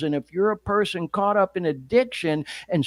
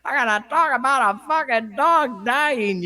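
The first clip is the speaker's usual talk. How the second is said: high-pitched imitation of an exasperated rant; strained mock tirade, not shouted; mock angry rant; 7 semitones higher than usual. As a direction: mimic a radio host losing his temper. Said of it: "went on a rant like".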